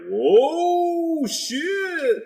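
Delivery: high, loud mock Canadian "Whoa, shit"; Canadian accent. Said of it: "all Canadian right now".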